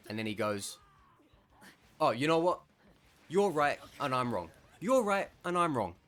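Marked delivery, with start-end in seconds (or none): 2.0-6.0 mock-gracious, sarcastic and loud; snarky sarcastic concession. Man at the gate sarcastically conceding the argument, snarky. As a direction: concede with a sneer. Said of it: "he was being snarky and sarcastic".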